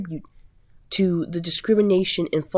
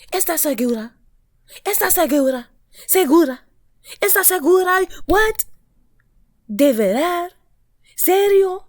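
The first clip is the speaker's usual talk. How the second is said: high, rising Spanish questions, building louder; hammed-up Spanish disbelief; mock shocked Spanish; 10 semitones higher, 4 dB louder than usual. Mocking the Colombian girl in Spanish, high hammed-up shock. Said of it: "I'm just playing. I'm practicing my Spanish".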